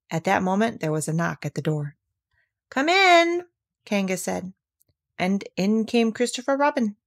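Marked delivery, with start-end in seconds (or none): 2.7-3.5 high sing-out call; high, drawn-out invitation. A narrator's reading. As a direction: call to someone at the door. Kanga calls to someone knocking.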